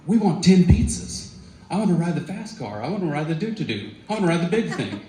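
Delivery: high, loud mock kids begging; kids clamoring. Teacher acting out kids in Gatlinburg, high and loud. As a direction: whine like greedy kids at a fair. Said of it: "And he goes".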